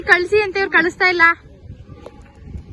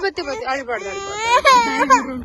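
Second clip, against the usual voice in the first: soothing; slower than usual. Real, not acted. Soothing crying child.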